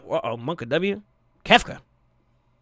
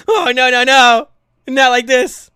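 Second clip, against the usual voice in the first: mock panic; 5 semitones higher, 7 dB louder, slower than usual. Real, not acted. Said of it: "I'm internally panicking".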